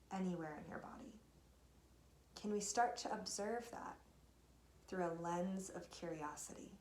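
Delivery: calm, unhurried guidance through breath and body; guided meditation. Real, not acted. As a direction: guide listeners gently inward, leaving space between phrases.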